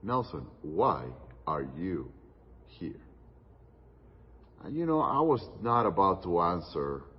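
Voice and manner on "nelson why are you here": low, soft and grave, slowed down; a friend asking a hard question in a serious hush. Retold or imitated. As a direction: ask it gravely and quietly, weighing each word. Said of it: "in a very serious tone".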